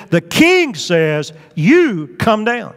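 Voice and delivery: slow, emphatic angry command, each word punched; arrogant, mad. Acted. King's captain orders the man of God down, arrogant and mad. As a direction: play an arrogant officer giving a slow, forceful order. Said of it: "he's arrogant, he's mad".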